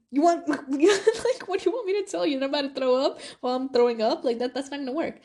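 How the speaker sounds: high-pitched, laughing disbelief; incredulous retort. Retold or imitated. Student answering the school's throw-up rule, incredulous. Arguing back at school's complaint.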